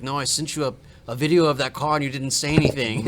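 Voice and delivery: mock German accent, reproachful. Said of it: "sounds German".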